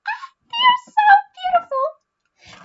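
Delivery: very high, drawn-out coo of adoration; cute gushing squeal. Real, not acted. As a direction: squeal slowly and loudly over something adorable. Gushing over cute cat paper clips.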